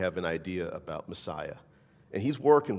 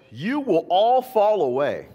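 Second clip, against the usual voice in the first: Jesus's warning; 11 semitones higher, 9 dB louder, slower than usual. Acted. Jesus warning his disciples.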